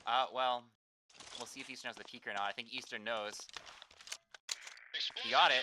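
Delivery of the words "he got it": excited. Real, not acted. Caster calling a bomb plant.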